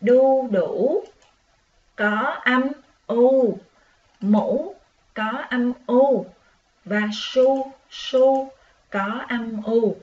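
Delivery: each word drawn out slowly, u stressed; teacher stretching đu đủ, mũ, su su slowly; slow stressed sounds. Shown as a demonstration. Teacher showing children the shared u sound, slow and stressed. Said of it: "chúng ta thấy nè".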